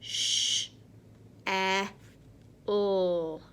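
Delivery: Sh, eh, l sounded separately; sounding out words. Shown as a demonstration. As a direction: stretch each sound apart. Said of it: "Let's do that one again".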